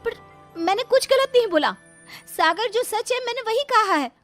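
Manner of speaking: very high-pitched pleading wife; high, distressed young bride; indignant protest. Acted.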